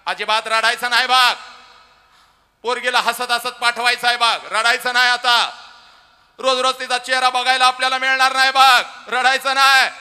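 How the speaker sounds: high, loud scolding shout; a father forbidding tears at full volume. Retold or imitated. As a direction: yell at the crying mother to stop. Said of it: "आईला ओरडतो".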